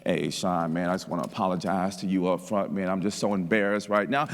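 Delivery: mock-ashamed coworker apologizing to his boss; embarrassed trembling voice. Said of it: "you can hear the tremble in his voice".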